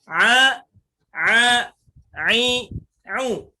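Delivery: loud ain vowel drill. Shown as a demonstration. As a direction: chant the ain vowels from the throat. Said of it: "Tetapi harus".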